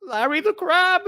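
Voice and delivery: very high squeaky showman, ending in a forced laugh; reassuring crab mascot. Acted. Mascot telling kids he is fine, high strained cheer. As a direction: keep up the high mascot act for the children.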